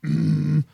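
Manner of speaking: rough throat-clearing demo. Shown as a demonstration. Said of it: "clear your throat".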